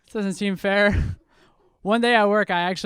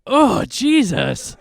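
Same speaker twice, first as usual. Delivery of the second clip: drawn-out, louder, higher shocked Oh, laughing; horrified oh Jesus; 6 semitones higher, 8 dB louder, much slower than usual. Retold or imitated. Crowd seeing him shaved, slow shocked gasp. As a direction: recoil in slow horror at the ugly face. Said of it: "be like".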